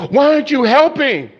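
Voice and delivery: high, indignant demand; outraged question. Acted. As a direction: demand an answer in disbelief. Crowd confronts idle captain.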